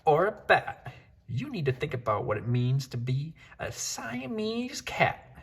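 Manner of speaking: high mother-cat voice with sing-song list; lecturing mama. Acted. Mother cat listing animals he is not, high and sing-song. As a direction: rattle off the animals like a stern mom.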